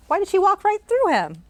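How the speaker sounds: ordinary delivery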